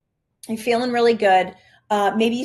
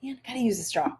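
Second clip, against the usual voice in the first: soft, easy-going mutter to herself; unbothered shrug; 8 dB quieter, faster than usual. Retold or imitated. To herself over the shirt stain, softly unbothered. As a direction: let it go lightly, no annoyance. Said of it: "I'm just like".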